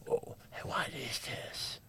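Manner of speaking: slow cinema whisper. Retold or imitated. Donald nudging his host halfway through the film, whispered. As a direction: imitate someone whispering to his neighbour mid-film. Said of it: "tapping me on the shoulder like this".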